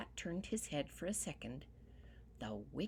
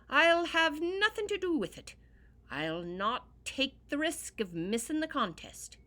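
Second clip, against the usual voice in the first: loud, high refusal; flatly refuse, loud and high; firm declaration; 9 semitones higher, 9 dB louder than usual. Acted. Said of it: "declared young Tom".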